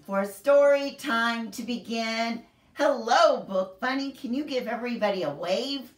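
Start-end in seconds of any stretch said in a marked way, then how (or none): none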